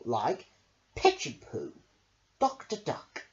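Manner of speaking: ordinary delivery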